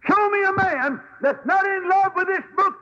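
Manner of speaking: preacher exalting the Bible to the congregation; preaching at full force. Acted. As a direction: proclaim it to the back row. Preaching climax about the book.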